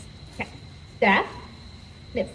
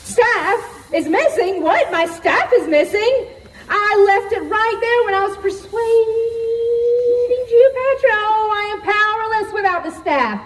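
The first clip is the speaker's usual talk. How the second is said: much higher, rising panic; panicked; 9 semitones higher than usual. Acted. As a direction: spiral into frantic alarm. Panics over lost staff.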